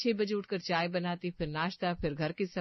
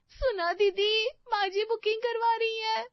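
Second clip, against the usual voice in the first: much higher, delighted squeal of news; thrilled; 4 dB louder than usual. Acted. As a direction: burst with joy. Daughter-in-law thrilled at booking news. (बहुत ऊँची, ख़ुशी से उछलती आवाज़)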